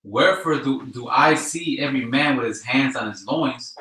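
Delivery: scripture read at full force; loud scripture reading. Acted. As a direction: proclaim it like a preacher.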